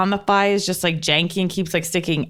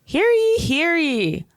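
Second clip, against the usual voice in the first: town crier; 8 semitones higher, much slower than usual. Acted. Ad opens like royal proclamation.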